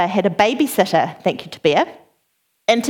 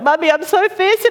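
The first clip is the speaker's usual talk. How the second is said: put-on high child voice; higher-pitched young whine; pleading child; 8 semitones higher than usual. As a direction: imitate a child chiming in with a plea. Second child joins the pleading.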